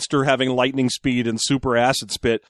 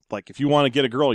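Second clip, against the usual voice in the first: normal talking voice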